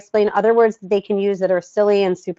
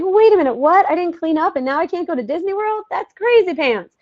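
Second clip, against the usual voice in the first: much higher, hammed-up disbelief; a high, playful cry of indignation; mock outrage; 8 semitones higher than usual. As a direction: act shocked at losing Disney World. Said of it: "'Cause I would be like".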